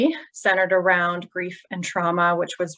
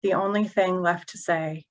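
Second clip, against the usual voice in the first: measured elegy in short, broken phrases; elegy recitation. Acted. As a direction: recite haltingly, pausing between fragments of verse. Reads elegy for sister.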